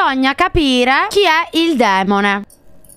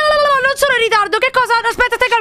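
high, fast protest; flustered panic; 10 semitones higher, much faster than usual. Real, not acted. Streamer protesting she is not late, flustered panic. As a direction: blurt it out in alarm.

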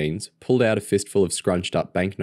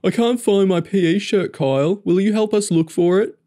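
high, loud mocking whine; hammed-up mimicry of a helpless boy; mocking James's voice; 13 semitones higher, 5 dB louder than usual. A narrator's reading. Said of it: "Kyle mocked James's voice".

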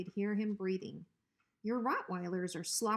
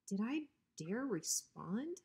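ordinary delivery, nothing marked

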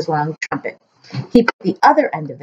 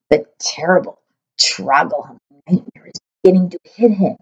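ordinary delivery

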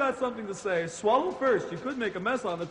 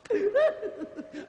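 high cackle; 11 semitones higher than usual. Acted. Griselda laughs.

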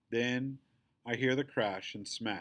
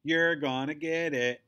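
slow, loud, menacing bully growl; growled threat; 5 semitones higher, 6 dB louder than usual. A narrator's reading. Bully glaring down at the girl, growling a threat. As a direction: threaten her with a drawn-out growl. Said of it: "growls bully head number one".

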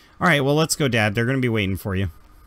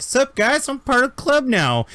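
put-on much higher, swaggering kid voice; cocky teen; 13 semitones higher, 4 dB louder than usual. Sarah joining the crew, high swaggering teen voice. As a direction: strut in like the new cool kid, way up high. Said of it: "walking up to the rest of the crew like".

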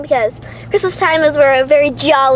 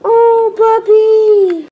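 high, loud, very slow drawn-out coo; 7 semitones higher, 5 dB louder, much slower than usual. Real, not acted. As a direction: stretch it out adoringly. Cooing at a puppy.

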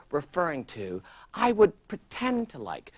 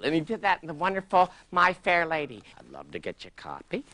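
usual speaking voice

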